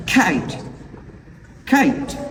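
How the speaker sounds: "kite" ending on a T without a puff; unaspirated final T. Shown as a demonstration. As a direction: close "kite" on a barely released T. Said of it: "T sound at the end".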